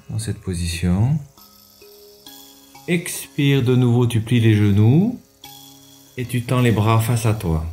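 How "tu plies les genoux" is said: usual speaking voice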